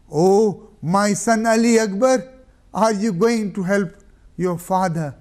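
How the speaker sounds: loud, high cry into emptiness; anguished call. Acted. Hussein crying out for his fallen companions. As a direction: call out to the field. Calling his dead son.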